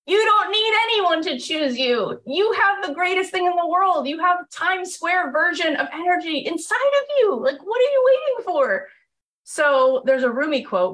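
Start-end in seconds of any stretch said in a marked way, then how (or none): 0.1-8.9 high, rising pep-talk fervor; rousing. Real, not acted. Urging the audience to trust their energy, high and fervent. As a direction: lift it up and urge them on.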